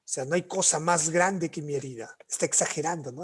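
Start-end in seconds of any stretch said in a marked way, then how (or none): none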